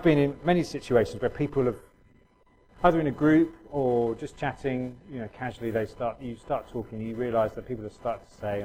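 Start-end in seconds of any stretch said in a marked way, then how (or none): none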